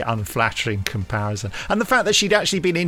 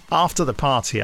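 ordinary talk, nothing marked

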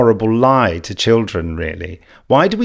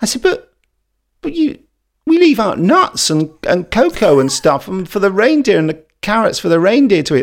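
a lightly voiced young child, pitched higher and pleading; childhood self; 7 semitones higher than usual. Little boy protesting to his parents about the reindeer snacks, in a higher pleading voice. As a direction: plead like a bewildered little kid.

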